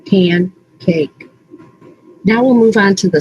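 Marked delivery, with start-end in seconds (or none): 0.1-1.3 "Pancake" broken as pan, pause, cake; split into word parts. Shown as a demonstration. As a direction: separate "Pancake" into two distinct pieces. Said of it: "What are the parts in pancake?".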